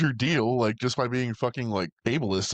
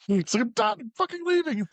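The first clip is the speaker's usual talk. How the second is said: stubborn refusal; 12 semitones higher than usual. Retold or imitated.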